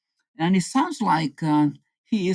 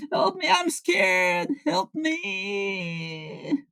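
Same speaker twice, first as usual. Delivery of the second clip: high crying plea for help; terrified; 12 semitones higher, 3 dB quieter, much slower than usual. Pretend. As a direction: sob it out in high panic. Henry pretends terror of hamburgers.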